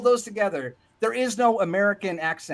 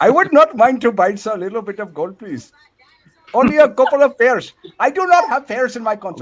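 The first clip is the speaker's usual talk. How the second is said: put-on loud, heavily accented customer; formal broken English in a foreign accent; mock foreign accent; 4 semitones higher, 7 dB louder than usual. Answering the goat seller's offer, mock foreign accent.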